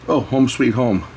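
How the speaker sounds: ordinary talk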